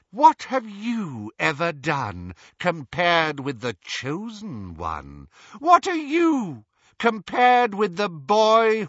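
high, loud, drawn-out scorn; scornful taunting. Acted. Riddle Hermione belittling Ron beside Harry, scornful taunt. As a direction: sneer it high, stretching the key words.

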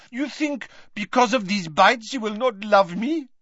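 put-on high French-accented challenge; French accent, demanding. A narrator's reading. Fleur demanding of Mrs Weasley, French accent. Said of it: "demanded Fleur".

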